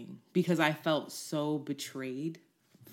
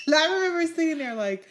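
laughing; 12 semitones higher, 6 dB louder, faster than usual. Real, not acted. Still laughing.

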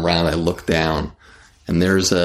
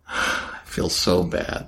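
said slowly on a soft, unvoiced sigh; rueful sigh, almost no voice; 3 semitones lower, 5 dB quieter, slower than usual. Real, not acted. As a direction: breathe it out, sorry for him. Repeats line, second with a sigh.

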